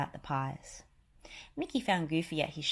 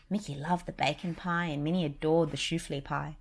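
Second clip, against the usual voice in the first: plain reading voice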